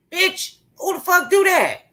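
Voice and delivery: high, disbelieving squeal; incredulous squeal. Real, not acted. Ranting at her online viewers, a high disbelieving squeal. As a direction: shriek it in disbelief. Outraged at someone crying after talking.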